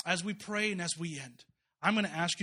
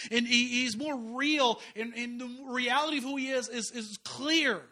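loud, slow, higher preaching climax; preaching at full force; 6 semitones higher, 6 dB louder, slower than usual. Acted. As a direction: drive it home like a revival preacher.

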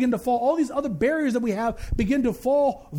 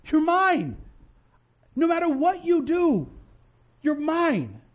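slow, tender voice of God, rising with feeling; gentle divine assurance, drawn out; God speaking tenderly; 3 semitones higher, much slower than usual. Acted. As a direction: reassure them, slowly and warmly. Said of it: "God still looks at you and says".

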